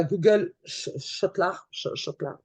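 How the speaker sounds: stumbling French-accented English; poor English accent. Real, not acted. Speaker apologises for their English before naming Google Scholar, French accent. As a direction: trip over the English name with a French accent. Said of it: "excusez-moi de l'accent, il est un peu pourri".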